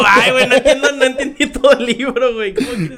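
high, loud, laughing whine; whiny laughing complaint. Retold or imitated. Mock whiny student reply. (queja chillona entre risas)

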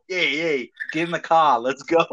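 playful put-on Boston accent. Host mimicking a good-looking Bostonian, Boston accent. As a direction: imitate a Bostonian hurrying people along. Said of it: "It's not the sexiest accent".

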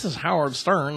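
a hint of early nasally radio host; Howard Stern voice. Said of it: "he had a real high pitched nasally voice".

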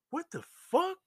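high, loud rising outburst; shocked disbelief. Retold or imitated. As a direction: act out an incredulous exclamation. Acting out shocked reaction next morning.

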